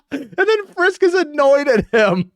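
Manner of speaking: high, loud words bursting through laughter; laughing hard. Real, not acted. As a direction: say it while cracking up. Describes gag while cracking up.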